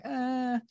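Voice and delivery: a high mock groan; hammed-up high moan; imitated nurse groan. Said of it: "they groan".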